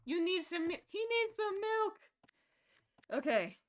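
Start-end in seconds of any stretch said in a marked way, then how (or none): 0.0-2.0 hammed-up high-pitched exclamation; mock cry. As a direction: stretch it out high like a silly plea. Shouting a quoted catchphrase.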